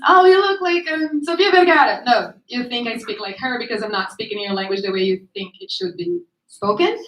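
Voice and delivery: usual speaking voice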